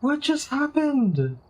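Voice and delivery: high, slow baffled questions; stunned disbelief. Real, not acted. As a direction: drag it out in disbelief. Stunned after losing.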